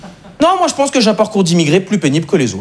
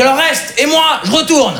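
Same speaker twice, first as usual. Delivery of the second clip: mock troll retort; 8 semitones higher than usual. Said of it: "Il dit".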